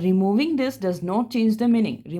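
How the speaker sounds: reads the rule out slowly for notes; slow, clear English rule read to students; slow dictation read. Shown as a demonstration. Teacher reading a grammar rule aloud to the class, slow and clear.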